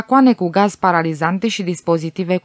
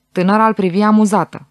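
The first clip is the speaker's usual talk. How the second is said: plain reading voice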